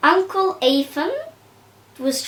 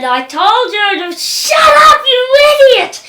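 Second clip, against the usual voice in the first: much higher, much louder shrieking yell; screaming laughing shout; 10 semitones higher, 9 dB louder than usual. Retold or imitated. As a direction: scream it through laughter, half crying. Papa yelling while laughing.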